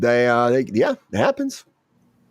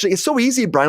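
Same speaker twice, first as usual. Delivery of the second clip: higher, breezy mock girlfriend voice; imitating girlfriend; 8 semitones higher than usual. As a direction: brush it off lightly. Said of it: "Sabrina's like".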